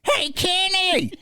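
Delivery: put-on very high, screechy-old-lady holler; high mimicked call, last word drawn out; granny calling out. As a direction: imitate a granny hailing someone from a window. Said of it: "I hear this voice".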